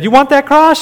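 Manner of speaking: high, loud questioning mom voice; mom's question. Retold or imitated. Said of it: "she said".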